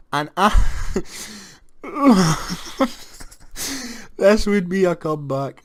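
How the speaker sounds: high giddy laugh, then a loud, high line; giddy laughing hope; laughing disbelief. Real, not acted. Laughing at possible comeback.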